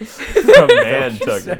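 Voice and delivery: squeaky high giggle through the words, slow; laughing. Real, not acted. Friends joking about a limerick, giggling high through the words.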